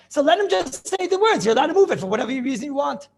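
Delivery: insistent, high-pitched rhetorical challenge; exasperated objection. Acted. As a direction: protest it as if it were obvious. Arguing against the Rambam's wording.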